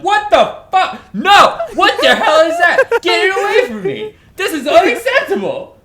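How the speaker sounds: high, shrieking freak-out; loud, comically outraged shriek; mock panic. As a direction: scream it like a horrified bus passenger, hammed up. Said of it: "everybody on the bus should be like".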